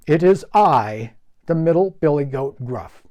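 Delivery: steady, clear goat voice; middle goat, firm. Acted. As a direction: answer level and clear. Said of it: "he answered".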